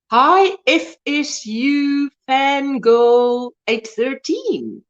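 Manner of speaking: slow, letter by letter; spelled out slowly. Real, not acted. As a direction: read each letter out slowly. Reading a channel name slowly.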